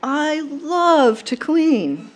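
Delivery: high, gushing grandma with a stretched "love"; emphatic grandmother. Acted. Granddaughter quoting Gram at her memorial, gushing declaration. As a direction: proclaim it slowly and grandly, for laughs. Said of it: "she would say".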